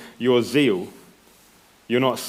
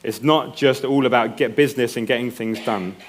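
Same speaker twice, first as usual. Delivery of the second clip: normal talking voice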